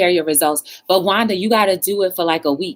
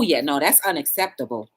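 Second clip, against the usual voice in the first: ordinary talk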